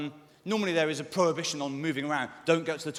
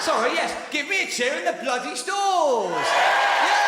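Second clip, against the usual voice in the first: high, drawn-out crowd-rousing yell; rousing shout; 12 semitones higher, 7 dB louder, much slower than usual. Real, not acted. Comic making amends to the stalls, rousing shout. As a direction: shout loud and high to whip up a cheer.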